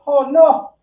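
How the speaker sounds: mother-in-law's shocked gasp; mock alarmed mother-in-law. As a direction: gasp it like a worried mom.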